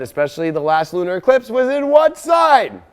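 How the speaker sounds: high, loud excited question; shouted game-show question; excited quiz. Real, not acted. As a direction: fire the question out to the crowd. Quizzing the audience loudly.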